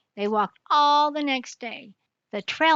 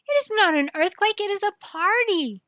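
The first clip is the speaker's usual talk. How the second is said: much higher, bright announcement; excited reveal; 9 semitones higher than usual. Acted. Detective tells the king it is a party, excited and high. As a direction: announce the good news with glee.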